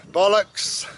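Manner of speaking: a loud, high shout of "Bollocks!"; gleeful shout. Real, not acted. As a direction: belt out the swear word for fun. Gleefully swearing after "beautiful word.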